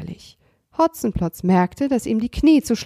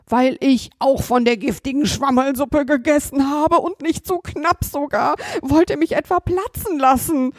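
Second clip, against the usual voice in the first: higher, whiny robber voice; pleading robber; 6 semitones higher than usual. Acted. Robber Hotzenplotz complains nobody helps him, pleading higher. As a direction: beg for help, aggrieved.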